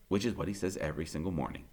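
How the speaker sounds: ordinary delivery, nothing marked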